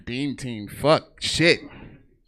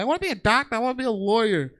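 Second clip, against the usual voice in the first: put-on higher eager kid voice; imitating kids; 10 semitones higher, 5 dB louder, faster than usual. Kids answering teacher, high and eager. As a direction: chirp it like an eager schoolkid.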